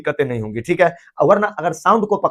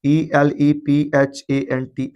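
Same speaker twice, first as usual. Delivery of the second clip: spelling aloud; 3 semitones lower, much slower than usual. Shown as a demonstration. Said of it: "अगर हम लिखते हैं elephant".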